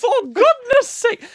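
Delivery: very high, slow, loud squeal; hammed-up shriek; shrieking mock exclamation. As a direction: scream it like a camp old aunt. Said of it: "joking demon intro".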